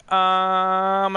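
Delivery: long drawn-out "um", held level; stumped. Retold or imitated. Mom put on the spot by a stranger, stalling. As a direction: imitate someone stalling for an answer.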